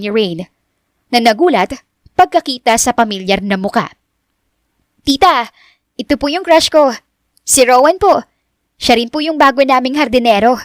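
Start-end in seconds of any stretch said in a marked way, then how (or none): 5.1-10.6 high, lively voice of a smitten niece; girlish high-pitched chatter; eager young woman. Acted. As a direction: introduce your crush, pitched up. Narrator voices Richelle introducing crush.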